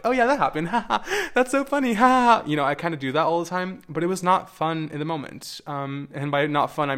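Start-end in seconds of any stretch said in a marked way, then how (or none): 0.0-2.4 much higher, breezy mock laughter; sing-song shrugging off a painful memory; fake cheerful laugh. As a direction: brush it off with a forced laugh. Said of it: "I'm like".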